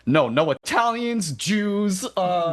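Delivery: high, dismissive cartoon refusal; Peter Griffin. Peter rejects Quagmire's list, high cartoon voice. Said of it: "And Peter is like".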